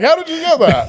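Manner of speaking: very high laughing squeak; squeaky judge voice. Retold or imitated. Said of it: "the judge is like".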